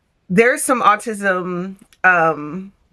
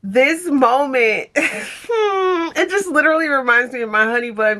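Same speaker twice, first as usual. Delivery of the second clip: laughing through tears; 5 semitones higher than usual. Real, not acted. Vlogger gushing about her fiance to viewers, laughing through tears. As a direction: voice breaking between laughs and tears, higher. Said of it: "so I'm emotional".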